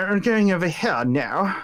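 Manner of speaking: hammed-up British accent; British snob imitation. Imitating the funny pteranodon for friends, mock British. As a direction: put on a snooty British voice. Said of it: "that's how he sounds".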